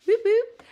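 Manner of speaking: squeaky high boop sounds; high playful boops. Real, not acted. Playful noise while working.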